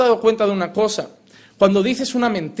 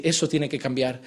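quiet inner voice; 7 semitones lower, 7 dB quieter than usual. Retold or imitated. The word speaking to the heart.

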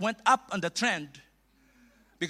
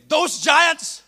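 put-on South African accent; 5 semitones lower, 4 dB louder, slower than usual. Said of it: "from South Africa, from Pretoria".

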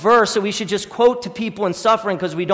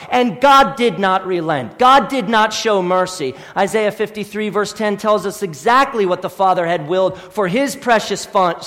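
ordinary talk

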